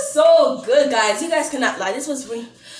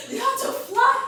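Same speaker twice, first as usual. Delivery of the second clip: excited high cry; 11 semitones higher than usual. Real, not acted. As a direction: pitch jumps high on fly. Arguing with mom over piercings.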